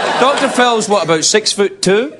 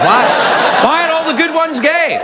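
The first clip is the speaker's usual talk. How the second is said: high-pitched mock lament; exasperated lady; 11 semitones higher, slower than usual. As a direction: voice a frustrated woman, pitched way up. Voices frustrated ladies at party.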